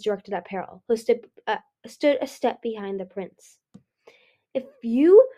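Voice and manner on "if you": choked fury, raised pitch on the opening words; choked-out threat. A narrator's reading. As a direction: force it out in broken, punched pieces. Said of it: "Ruby choked out".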